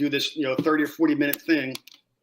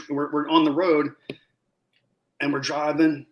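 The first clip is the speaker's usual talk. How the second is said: ordinary delivery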